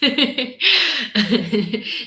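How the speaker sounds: amused giggling; laughing. Real, not acted. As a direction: burst out laughing. Laughing at greeting Rick's wife.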